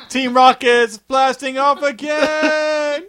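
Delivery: slow, high, loud catchphrase; cartoon villain cry. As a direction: stretch it out high like flying off.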